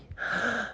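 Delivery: high soft amazed gasp; a quiet, delighted intake of breath; soft high gasp of awe; gasp of wonder. Shown as a demonstration. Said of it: "así como cuando uno se asombra".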